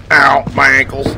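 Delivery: usual speaking voice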